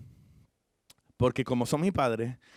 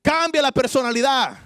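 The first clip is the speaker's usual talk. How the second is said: preaching rebuke; 10 semitones higher, 4 dB louder than usual. Acted.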